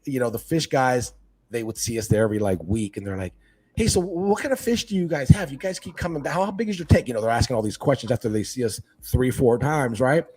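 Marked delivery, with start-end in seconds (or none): 3.7-7.1 curious store clerk. Retold or imitated. Voices curious fish store guys.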